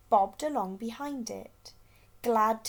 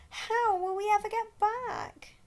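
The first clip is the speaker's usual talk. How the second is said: worried cry; 12 semitones higher, 4 dB louder than usual. A narrator's reading. Gasper scared adrift.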